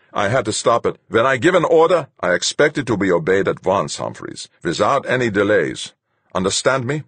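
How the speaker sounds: loud, high dressing-down; stern reprimand. A narrator's reading. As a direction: lay down the law as the captain. Fuchs reprimands Humphreys.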